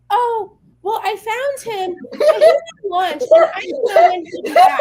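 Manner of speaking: high, laughing mimicry of the clerk; high mocking clerk voice. Retelling to friends, high laughing mock clerk. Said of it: "then she comes back and goes".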